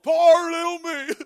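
whiny pity-party voice, lightly voiced; hammed-up pity party whine; mock self-pity. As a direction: play the sorry believer feeling sorry for himself. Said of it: "I'm gonna have a pity party".

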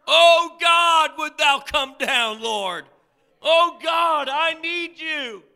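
loud, high, impassioned plea; drawn-out desperate prayer cry. Shown as a demonstration. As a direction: shout your need up to God. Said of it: "cry out to God from the depths of your heart".

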